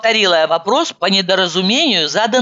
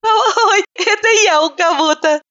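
laughing; 13 semitones higher than usual. A narrator's reading. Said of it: "рассмеялась она".

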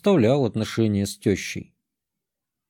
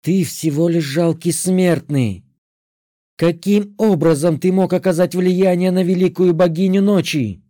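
growling; 6 semitones higher, 3 dB louder than usual. Acted. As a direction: growl down at a mere mortal. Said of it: "прорычала ведьма".

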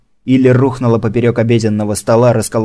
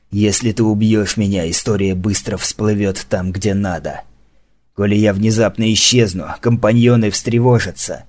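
stern commander voice; 3 semitones lower than usual. Acted. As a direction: lower the voice, grim and threatening. (низкий суровый голос командующего)

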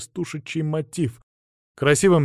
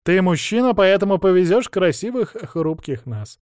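mock-coy teasing; 5 semitones higher, 4 dB louder than usual. Teasing bard into pulling cart.

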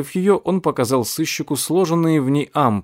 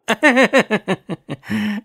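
high gleeful cackle; villainous laugh; 10 semitones higher than usual. A narrator's reading. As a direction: cackle with triumph.

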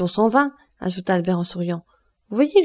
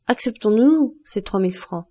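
plain reading voice

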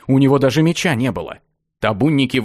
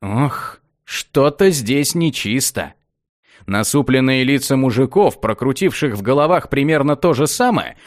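usual speaking voice

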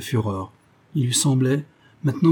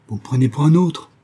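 louder, higher indignant cry; forceful raised-voice protest; violent shout; 4 semitones higher, 5 dB louder than usual. A narrator's reading. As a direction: shout it back in outrage. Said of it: "cria violemment Bobby Staff".

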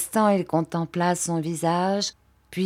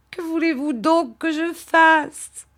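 desperate plea; 11 semitones higher, 4 dB louder than usual. Acted. Emily begs Rosa desperately.

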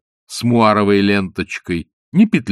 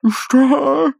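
high, alarmed question; startled old man; 4 dB louder than usual. A narrator's reading. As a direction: cry out confused. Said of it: "закричал беспокойный старик".

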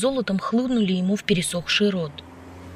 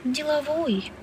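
soft, high-pitched sigh; sighed remark; 7 semitones higher, 6 dB quieter, slower than usual. A narrator's reading. Woman to the man with a meeting, sighing. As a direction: let the word out on a light sigh. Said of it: "вздохнула Аля".

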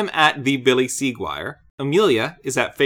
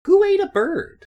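incredulous. Real, not acted. Incredulous question.

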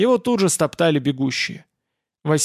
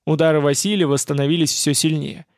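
usual speaking voice

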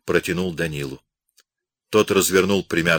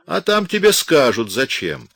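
smirking; 7 semitones higher, 4 dB louder than usual. A narrator's reading. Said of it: "и усмехнулся".

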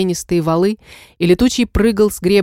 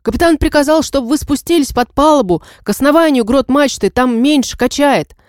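shouting over the wind; 7 semitones higher than usual. Acted. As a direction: bellow the message over roaring wind. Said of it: "прокричал сквозь шум ветра". (крик сквозь шум ветра)

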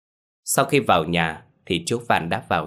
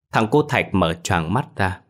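usual speaking voice

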